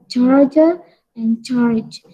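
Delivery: "Charger and charge" said CHAR-jer, slow; slow English charger; correct charger and charge. Shown as a demonstration. Teacher models "Charger and charge" slowly for students. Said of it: "Harusnya pengucapannya apa?".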